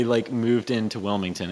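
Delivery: ordinary talk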